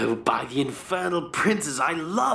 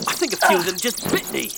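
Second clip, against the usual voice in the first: high, fast and louder panic; panicked; 4 semitones higher, 4 dB louder, much faster than usual. Acted. Companion bitten by bats at the grave, high panicked rush. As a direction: blurt it out in alarm.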